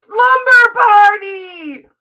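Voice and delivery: high, loud, slow drawn-out cheer; excited shout. Acted. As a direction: shout it like a party announcement. Announcing surprise slumber party.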